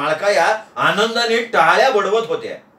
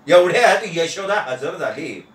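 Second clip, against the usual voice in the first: ordinary delivery, nothing marked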